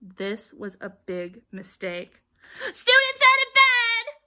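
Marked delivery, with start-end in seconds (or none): 2.6-4.1 very high, drawn-out screech of alarm; bellowing. A narrator's reading. Poltergeist raising the alarm on students, bellowed. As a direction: holler it out like a siren. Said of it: "Peeves bellowed".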